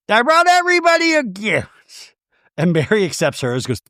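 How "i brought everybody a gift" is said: high, hammed-up grand announcement; Meredith. Castmate passing out gifts, theatrically high. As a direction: imitate a castmate proclaiming in a high put-on voice.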